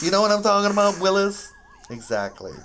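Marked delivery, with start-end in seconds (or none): 0.0-1.5 playful call-out. Pretend. Catchphrase aimed at Willis.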